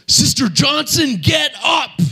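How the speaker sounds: very high shouted order; preacher commanding the dead to rise; angry command. Retold or imitated. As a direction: roar it at the dead woman. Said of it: "he started to get angry".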